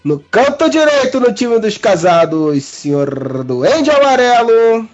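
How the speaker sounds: slow, drawn-out fight host announcement; ring announcer. Acted. Host keeps introducing fighters.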